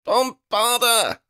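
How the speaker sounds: high, drawn-out spell cry; high-pitched battle shriek of a spell. A narrator's reading. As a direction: shriek the incantation slowly. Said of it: "Lydia shrieked".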